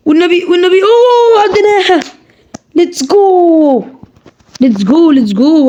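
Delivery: real victory shout, the last word drawn out slow; excited pleading to triumph. Real, not acted. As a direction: beg the game then cheer the win. Pleading then clearing the level.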